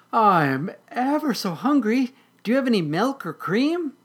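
very high, loud kitten voice; hungry kitten. Acted. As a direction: beg sweetly in a high little voice. Hungry kitten begs for milk.

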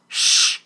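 sharp, forceful "shh"; loud shushing. Acted. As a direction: hush someone with a loud hiss. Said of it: "he whispered loudly".

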